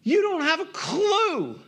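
high, impassioned, drawn-out plea; acted outburst of a desperate parent; pained parent's scream. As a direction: cry it out like a hurt parent, pitch soaring. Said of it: "wants to scream and say".

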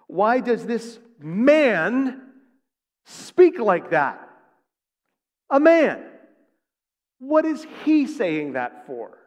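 loud, slow, drawn-out outrage; acted indignation. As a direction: stretch it out in high, scandalized disbelief. Scribes' outraged thoughts, acted out.

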